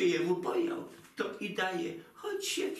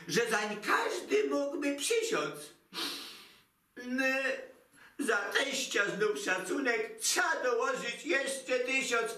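ordinary talk, nothing marked

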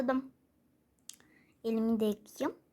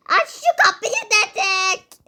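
slower, louder, hammed-up angry order; louder demand; 5 semitones higher, 6 dB louder than usual. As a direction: redo it, pressing every word, mock angry. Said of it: "o zaman videoya devam edelim".

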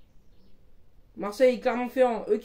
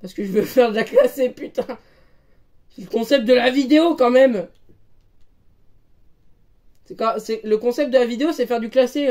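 raised, emphatic voice; loud insisting; 2 semitones higher, 6 dB louder than usual. Real, not acted. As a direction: push the point loudly. Insisting on ranked games.

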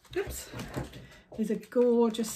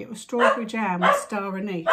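usual speaking voice

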